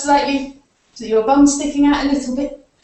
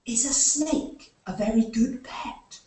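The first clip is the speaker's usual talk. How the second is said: hushed, slow whispered question; a slow secret whisper to the kids; 8 dB quieter than usual. Acted. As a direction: whisper it so the snake won't wake. Quiet question to children after snake game.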